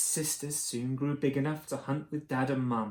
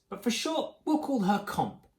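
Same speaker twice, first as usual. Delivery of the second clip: ordinary delivery, nothing marked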